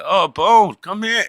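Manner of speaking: high, loud mock call; calling after someone. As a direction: imitate a relative calling him back. Relatives call after fleeing Bone.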